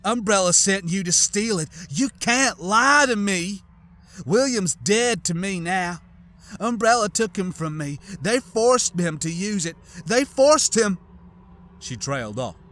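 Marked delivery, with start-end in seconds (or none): 0.0-9.8 bitter rant. Acted. Widow rants at intruder. 10.0-11.0 much higher, loud anguished repeat of forced; anguished cry. Acted. Repeats line, climaxing.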